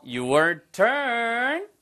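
high, held call to the learners; drawn-out cue. Real, not acted. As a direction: sing out the cue, stretching the last word. Cueing learners to answer.

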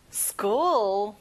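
"school" said clearly once as a model; model word. Shown as a demonstration. Teacher models "school" for students to repeat. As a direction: say "school" slowly, one clean syllable. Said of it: "발음과 강세 연습해 볼게요".

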